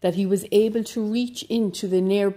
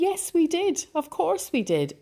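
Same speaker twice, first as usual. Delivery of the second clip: higher, cheeky talking cows; high cow voices; 10 semitones higher than usual. Acted. Cows answering the startled hero, high and smug.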